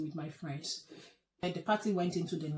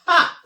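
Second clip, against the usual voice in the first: sharp incredulous ha; 11 semitones higher, 16 dB louder than usual. Real, not acted. Reacting to his order.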